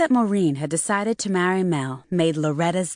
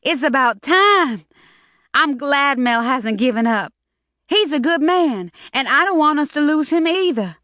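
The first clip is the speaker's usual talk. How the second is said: lightly raised woman voice; 8 semitones higher, 5 dB louder than usual. Acted. Character voiced in novel reading.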